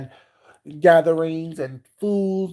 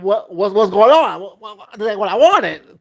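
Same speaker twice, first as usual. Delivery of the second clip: loud, higher, spoiled complaining; spoiled attitude; 6 semitones higher, 7 dB louder than usual. Retold or imitated. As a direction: whine like a spoiled baby. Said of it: "I come with the attitude".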